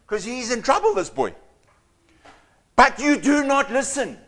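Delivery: ordinary talk, nothing marked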